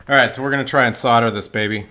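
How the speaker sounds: normal talking voice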